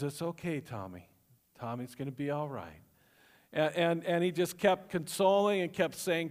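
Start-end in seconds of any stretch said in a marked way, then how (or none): 0.0-2.8 soft, low calming voice; calm soothing. Retold or imitated. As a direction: hush a screaming child gently. Calm dad soothing screaming child.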